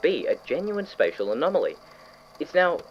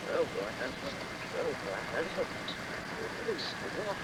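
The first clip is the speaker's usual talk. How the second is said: much softer, drawn in and dreamy; entranced murmur; 3 semitones higher, 11 dB quieter than usual. Acted. Scientist staring into the mouse hole, murmuring softly entranced. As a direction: murmur it as if hypnotised by the dark.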